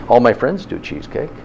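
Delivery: ordinary talk, nothing marked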